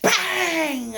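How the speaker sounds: sudden loud, slow, high outburst; exploding in anger. Retold or imitated. Coach showing her old anger, loud burst. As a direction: let it rip like losing your temper. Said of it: "and then I'd go".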